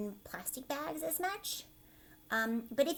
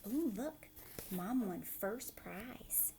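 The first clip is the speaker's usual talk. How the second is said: whispered aside; 10 dB quieter, much slower than usual. Real, not acted. Teacher reading a book to kids.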